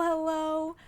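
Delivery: ordinary talk, nothing marked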